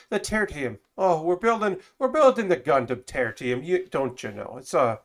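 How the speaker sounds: hammed-up Irish lilt; playful, wobbly attempt at an Irish accent; Irish brogue. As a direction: put on a mock Irish brogue. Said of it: "an Irish brogue".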